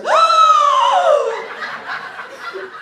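long, high, theatrical gasp; shocked gasp. Acted. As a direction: gasp in hammed-up horror, very drawn out. Greeter shocked she is Middle Eastern.

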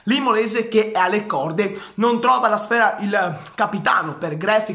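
ordinary talk, nothing marked

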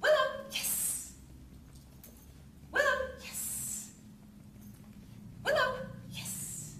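high call, then a breathy yes; happy name call. Real, not acted. Trainer calling puppy and marking the look.

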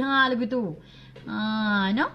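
ordinary delivery

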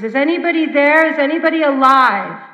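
searching voices shouting from afar; frantic calling. Retold or imitated. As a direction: call out frantically into the wreck. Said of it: "I heard the frantic voices".

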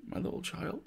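father breaks down, voice cracking high and soft; breaking down. Acted. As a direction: let the voice crack into a sob. He broke down all at once.

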